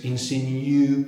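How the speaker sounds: "insinu" drawn out as English "in-sin-YOO"; English Y glide. Shown as a demonstration. Teacher shows the Y popping up in English, "insinu" with a y-glide. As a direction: say "insinu" slowly with a y-sound before the u. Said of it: "we have a Y popping up".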